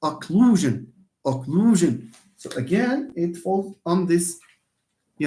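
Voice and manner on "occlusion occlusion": "Occlusion" with the weight on LU; stress on LU. Shown as a demonstration. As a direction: hit the middle syllable of "Occlusion". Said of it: "stress على لو".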